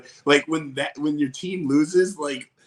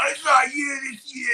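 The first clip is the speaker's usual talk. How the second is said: high, shouted East Coast fan impression; mock East Coast accent; 12 semitones higher than usual. Mocking East Coast tourists, shouted in their accent.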